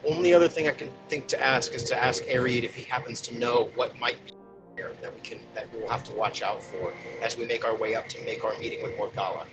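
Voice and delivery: normal talking voice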